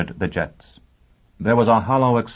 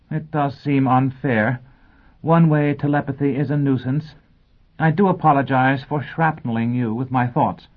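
bright, fluttery lady teacher, much higher; high schoolteacher voice; 6 semitones higher than usual. Acted. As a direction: play the scatterbrained teacher addressing her class. Teacher Robin voiced in story.

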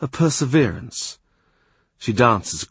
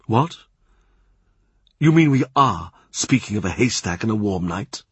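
stern Cardinal. A narrator's reading. Cardinal reacts to news.

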